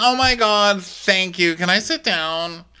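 imitating a fake-nice thank you; mock sugary thanks. [name] thanking Ross, sugary fake.

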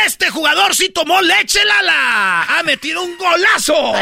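high shouted sponsor plug; Mexican goal commentator. Acted. Mexican announcer celebrates a goal, high excited shout. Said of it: "cuando meten gol dicen".

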